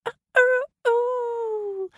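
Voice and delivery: high, hammed-up crying wail; mock wail. The hen wailing at the peddler, hammed-up. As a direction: wail as if heartbroken.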